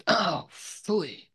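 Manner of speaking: high put-on pouting phooey; a petulant, foiled little oh phooey; foiled enemy grumbling in a high mock voice; disappointed enemy. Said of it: "who says".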